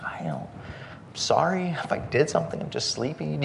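hushed, half-whispered mock apology; meek apology. Sleepy traveler apologizing to a yelling lady, meek and hushed. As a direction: plead softly, acting out helpless confusion.

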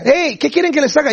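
very high imitated holler; grito desde lejos. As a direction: hail them from far off. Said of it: "Les grita y les dice desde allá". (grito agudo imitado, llamando desde allá)

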